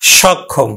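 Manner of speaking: সক্ষম as shawkkhom, low falling tune; open vowel. Shown as a demonstration. Teacher summing up the pronunciation, open shawkkhom. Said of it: "আমরা উচ্চারণ করলাম".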